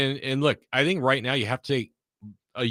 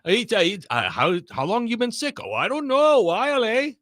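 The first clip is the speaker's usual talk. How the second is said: much higher sing-song Minnesota voice ending on "eh"; Minnesota accent; 10 semitones higher than usual. Retold or imitated. Mock Minnesotan small talk for the host, high and sing-song. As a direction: play a folksy Minnesotan chatting. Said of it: "Oh, Minnesota!".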